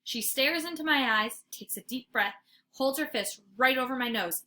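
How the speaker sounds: ordinary delivery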